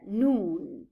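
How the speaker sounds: "noon" held long as the stressed syllable; main stress. Shown as a demonstration. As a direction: isolate and stretch "noon". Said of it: "the main stress would be here".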